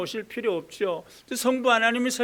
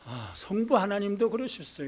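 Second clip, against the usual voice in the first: usual speaking voice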